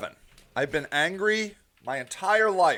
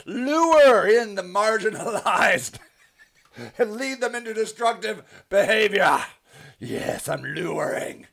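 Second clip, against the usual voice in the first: hammed-up villain reading, drawn out slowly; mock sinister. Streamer reading Judy's accusation aloud, mock sinister and slow. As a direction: stretch the words out with gleeful mock menace.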